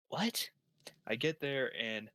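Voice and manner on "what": high-pitched baffled question; incredulous. Acted. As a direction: jump up high in disbelief. Baffled by being shown the ovens.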